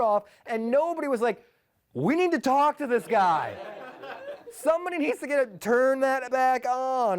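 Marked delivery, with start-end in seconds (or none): 1.9-7.2 exasperated plea. Retold or imitated. Exasperated imagined controller.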